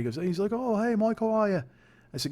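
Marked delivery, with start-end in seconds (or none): 0.5-1.6 high-pitched imitation of an English flatmate; English accent. Flatmate greeting him in the living room, imitated English accent. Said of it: "your English accent is shit".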